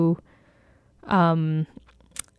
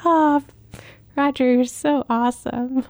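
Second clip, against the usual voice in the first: a higher, dreamy, lightly swooning voice; mock swooning fan; 4 semitones higher than usual. Fan gushing over Roger on screen, lightly swooning. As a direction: gush over him playfully, pitched up. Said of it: "I just a smile".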